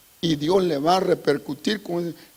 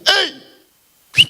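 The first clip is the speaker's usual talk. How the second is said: much higher, loud calling hey; high shout; 13 semitones higher, 7 dB louder than usual. Acted. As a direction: yell to stop him in his tracks. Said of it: "Y le grita y le silba".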